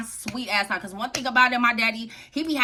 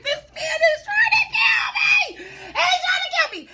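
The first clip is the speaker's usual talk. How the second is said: loud, very high wailing scream, half laughing; hammed-up panic; 5 dB louder than usual. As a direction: shriek for help in comic terror. Reenacts panic during the beating.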